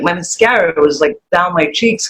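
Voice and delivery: usual speaking voice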